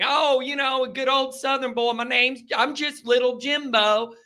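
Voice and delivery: a lightly put-on Southern accent, playing innocent; mock Southern charm. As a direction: act the aw-shucks Southern boy, mockingly. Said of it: "that southern charm".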